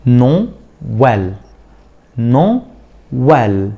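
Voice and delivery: نو ويل with a clear break between نو and ويل; split syllables. Shown as a demonstration. Teacher to students, showing the break in نو ويل. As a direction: say نو, pause, then ويل. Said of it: "باجي في وقفة في الصوت".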